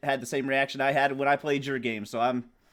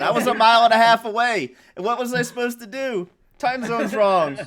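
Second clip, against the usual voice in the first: frantic excuse; 8 semitones higher, 8 dB louder than usual. Retold or imitated. Mocking Eric's frantic excuse.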